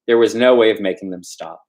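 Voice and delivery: ordinary delivery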